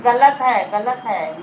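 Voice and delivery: sharp, loud burst of denial; loud protest. Real, not acted. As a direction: cut it off loudly, this is wrong. Protesting a wrong claim. (ज़ोर से विरोध)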